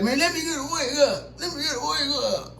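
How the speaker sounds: high, pleading crackhead voice; uncle's friend begging for a wake-up, high and pleading. Retold or imitated. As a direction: beg like a strung-out friend. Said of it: "Doing some shit like that".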